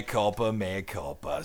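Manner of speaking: fading to a whisper-soft mea culpa; a mock confession trailing off into silence; fading apology. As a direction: let each "mea culpa" shrink, ending very soft.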